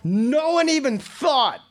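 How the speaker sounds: high, drawn-out mock outburst; retold outburst. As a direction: stretch it out high, as if astonished. Retelling an incredulous outburst on stage.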